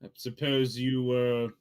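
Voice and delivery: mysterious NPC voice. Acted. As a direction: play him low and knowing, savoring each word. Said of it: "He turns to you and goes".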